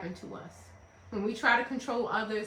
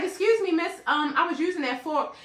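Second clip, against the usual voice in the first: high, louder imitation of a diner; mock objection; 5 semitones higher, 4 dB louder than usual. Diner whose fork was taken away, mock objection. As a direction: politely protest in a raised voice.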